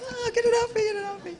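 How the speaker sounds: high squealing panic about bugs; shaking off a spider. Retold or imitated. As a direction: yelp it, wiping bugs off. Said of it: "It's like me with bugs".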